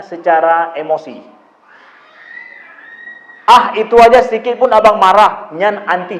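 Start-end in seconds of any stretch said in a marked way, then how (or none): 3.5-6.2 louder, scoffing mother brushing off the anger; dismissive parent. Retold or imitated. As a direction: wave the child's anger away mockingly. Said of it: "jangan pernah ngomong begitu". (ibu meremehkan, nada mengejek)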